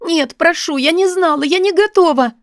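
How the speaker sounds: high, panicked protest; desperate pleading. Acted. Woman protesting she was unprepared, desperate pleading. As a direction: plead in a high voice, rising in urgency.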